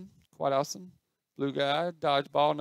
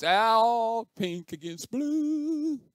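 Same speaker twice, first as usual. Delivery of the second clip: high, drawn-out match announcement; hyped-up game announcer, rising high; mock announcer; 8 semitones higher, 5 dB louder, much slower than usual. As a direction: stretch the words out like an arena announcer.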